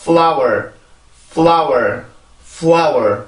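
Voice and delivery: "flower" with short F, stressed L, final R; English pronunciation demo. Shown as a demonstration. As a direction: model "flower" as careful, falling "fl-ow-er". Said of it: "한 번 더". ("flower"를 짧은 F, 힘준 L, 끝 R로 발음)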